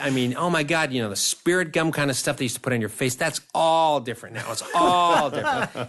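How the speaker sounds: high, laughing, upbeat sales pitch; breezy reassurance, half laughing; breezy producer reassurance. Retold or imitated. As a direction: oversell it with a laugh. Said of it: "And Rob said".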